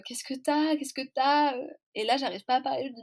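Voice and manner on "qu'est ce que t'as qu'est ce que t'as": a higher, louder worried question, repeated; alarmed. Retold or imitated. Grandmother alarmed at her granddaughter's tears, higher and louder. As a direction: ask in sudden alarm, pressing for an answer.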